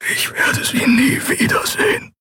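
voiceless, despairing whisper; faint grieving whisper; barely audible. A narrator's reading. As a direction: whisper it as if only to yourself. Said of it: "so leise, dass Andriel nur glaubte".

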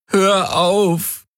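high, pained whimper. A narrator's reading. Garland whimpering at the Helix to stop. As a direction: whimper it, broken by pain. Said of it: "wimmerte er".

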